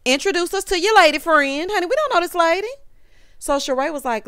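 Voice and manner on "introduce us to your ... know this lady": high, louder nosy demand; teasing call-out. Retold or imitated. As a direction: call him out playfully. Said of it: "thinking to myself".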